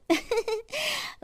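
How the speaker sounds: coy giggle. A narrator's reading. Bahu giggles before asking.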